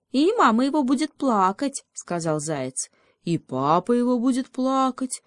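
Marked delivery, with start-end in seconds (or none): 0.1-1.8 high-pitched mock lament; pitying hare. Заяц притворно жалеет волчонка. 3.2-5.2 high little-beaver mock lament; feigned pity. A narrator's reading. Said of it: "притворно пожалел папу волчонка бобрёнок".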